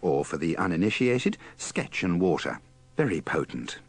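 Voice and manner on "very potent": ordinary delivery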